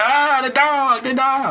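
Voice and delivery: high, slow hammed-up cry of a fleeing kid; mock kid panic. Imitating kids fleeing a dog, a high drawn-out mock wail. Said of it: "you hear everybody running, like".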